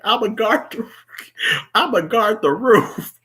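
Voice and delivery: high, amused mock of a man slipping away; imitated sneaky excuse. Mimicking O'Neill trying to dip, high mock excuse. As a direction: pitch it up, stretching the final excuse. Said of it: "but the way he said it".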